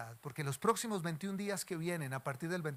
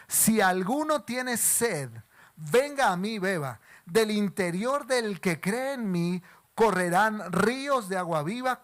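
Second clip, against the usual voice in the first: slow, loud, raised calling out; loud proclamation; 2 semitones higher, 9 dB louder, slower than usual. Acted. Jesus calls out to the feast crowd, slow and loud. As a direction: proclaim it slowly to the whole crowd. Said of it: "en voz alta dijo".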